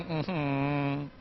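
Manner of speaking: soft, pouty whimper; comic child crying. Shown as a demonstration. Teacher showing how a six-year-old cries. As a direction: whimper like a sulky little kid. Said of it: "So this is a child crying".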